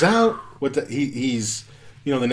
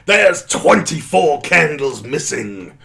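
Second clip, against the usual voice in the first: Christopher Lee voice; 4 dB louder, slower than usual. Retold or imitated. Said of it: "he's like".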